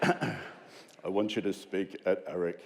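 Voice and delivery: low, slow, grave boss voice on the phone; imitating the boss. The boss John McGrath calling [name], low and slow. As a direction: deepen and slow it like a godlike caller. Boss invites him to speak.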